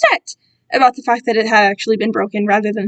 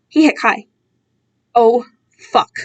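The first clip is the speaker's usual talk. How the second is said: ordinary delivery, nothing marked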